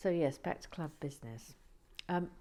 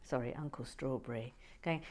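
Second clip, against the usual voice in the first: normal talking voice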